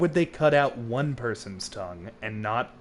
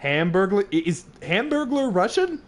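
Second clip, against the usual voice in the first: excited; 7 semitones higher, 4 dB louder than usual. Real, not acted. Silly joke about Russian.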